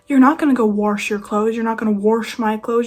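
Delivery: "wash" mockingly said as "warsh" each time; mispronounced wash. Telling mispronouncers off about laundry, "wash" with an added R. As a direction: throw the R back into "wash". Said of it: "There's no R in there".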